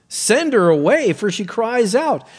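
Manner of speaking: high, loud demand; acted disciples' urgent plea; urging. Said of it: "and urging him".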